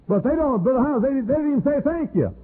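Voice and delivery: whiny complainer. Retold or imitated. Mimicking a complainer.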